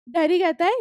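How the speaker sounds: ordinary talk, nothing marked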